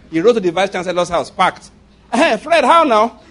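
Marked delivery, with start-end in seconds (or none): 2.1-3.2 high, loud, friendly call; hearty greeting. Retold or imitated. Bookseller greets vice chancellor.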